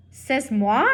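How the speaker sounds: the mother mouse indignantly asking if it is her fault, in French. Acted. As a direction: fire it back, outraged and French.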